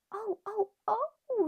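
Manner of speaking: high loud alarmed yell. A narrator's reading. Boy yelling as Ping splashes, high and loud. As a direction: cry out high, scared for the duck. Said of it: "yelled the little boy".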